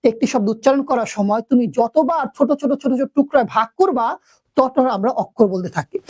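ordinary delivery

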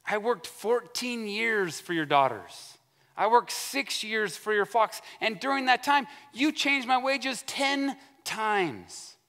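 high, drawn-out grievance; mock crying out. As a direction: act out piling up complaints, stretching the last. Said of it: "He cries out all these things".

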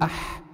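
أح pushed out hard and loud from the throat; loud throat demo. Shown as a demonstration. Teacher exaggerating the haa for the class, loud. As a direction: blast أح from the mid-throat. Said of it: "تسكن الحرف وتضع قبله همزة وصل".

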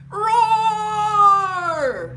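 loud high sung roar; playful lion roar. Acted.

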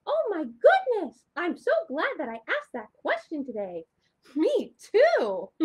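high, loud, thrilled puppet voice; excited puppet Shimmer. Acted. Shimmer the puppet thrilled about the mermaid book, loud and high. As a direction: burst out like a delighted puppet.

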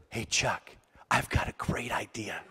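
low, hushed scheming voice; conspiratorial. Retold or imitated. Brother lets Chuck in on a prank, conspiratorial. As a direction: drop it low, plotting a prank.

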